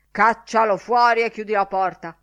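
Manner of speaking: loud, brusque command; ordering. A narrator's reading. Director telling professor to eject boy, ordering. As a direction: order him out forcefully.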